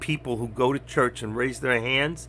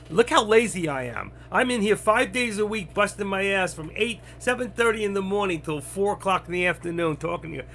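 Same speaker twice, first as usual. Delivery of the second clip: higher-pitched, sarcastic self-defence; indignant retort; 6 semitones higher than usual. Real, not acted. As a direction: throw the lazy accusation back, pitched up. Sarcastic retort to being called lazy.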